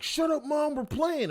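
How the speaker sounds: put-on high, rude kid snapping at his mom; spoiled kid yelling at his mother; bratty kid. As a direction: play a bratty kid shouting back.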